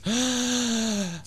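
a big, high gasp for air; exaggerated deep gasp. Shown as a demonstration. Host shows the caller how to take a deep breath, with a big hammed-up gasp. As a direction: suck in one huge theatrical breath. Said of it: "Breathe, try, try this".

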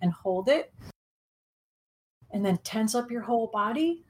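calm guided meditation voice. Acted. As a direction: guide them gently into the present.

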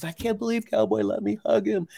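high, delighted voicing of the young man; thrilled disbelief. Veteran marveling that the horse let him hug it, high and delighted. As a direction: squeal it out like you can't believe your luck.

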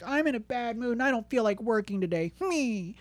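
high-pitched, hammed-up sulky whine; mock whining. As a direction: whine it like a put-on grumpy worker. Mocks a sulky worker.

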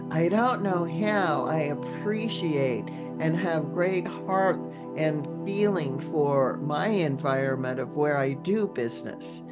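usual speaking voice

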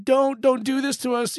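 high, urgent plea; begging demons. Acted.

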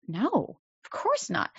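mock child scoffing at an obvious question; incredulous child. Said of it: "They're gonna say".

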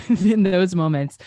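laughing. Real, not acted. Self-mocking laugh at sulky memory.

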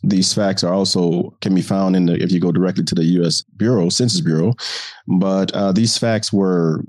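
normal talking voice

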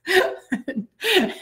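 high, bright laugh; burst of laughter. Real, not acted. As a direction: let a real laugh burst out. Laughing at the memory.

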